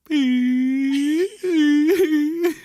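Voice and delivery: high loud shriek of laughter. Real, not acted. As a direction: let out a high squealing laugh. Makeup looks like a bruise.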